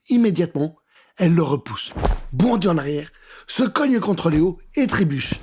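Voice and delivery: ordinary delivery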